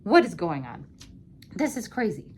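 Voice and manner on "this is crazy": usual speaking voice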